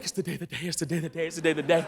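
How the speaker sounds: low, soft anxious chant to yourself; hushed dread-filled repetition; nervous muttering. Retold or imitated. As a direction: mutter it over and over under your breath. Nervous recruits each morning.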